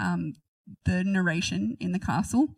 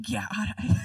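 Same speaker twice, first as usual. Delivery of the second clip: whisper. Real, not acted. Giggling aside.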